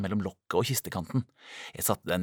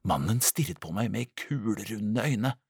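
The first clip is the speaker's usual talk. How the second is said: plain reading voice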